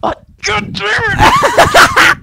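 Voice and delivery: slow, drawn-out high shout; frustrated yell. Real, not acted. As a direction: let it out long and loud. Loses again, drawn-out yell.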